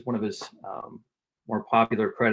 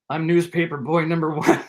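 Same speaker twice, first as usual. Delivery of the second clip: higher, sheepish announcement; nervous small-part actor; 5 semitones higher than usual. Acted. Bit player answers the star, high and sheepish. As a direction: pipe it up like an awed bit player. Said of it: "He goes".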